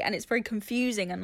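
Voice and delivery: usual speaking voice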